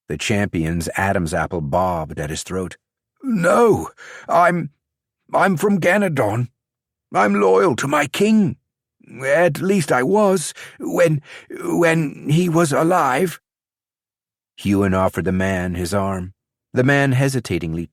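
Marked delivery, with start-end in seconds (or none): 3.2-13.4 high, halting, slowing at the end; frightened stammer. Acted. Captured champion confessing his loyalty, frightened and stammering. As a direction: stumble through it, scared to be caught out.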